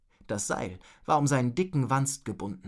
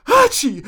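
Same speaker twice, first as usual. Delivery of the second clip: very high, loud "hatschi"; explosive mock sneeze; acted sneeze; 14 dB louder than usual. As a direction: burst into a sneeze mid-sentence. Sneezy character interrupts himself mid-sentence.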